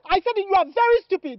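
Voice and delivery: loud, high insult; angry yell. Acted. Girl yelling an insult at the pestering man. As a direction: shout it in his face.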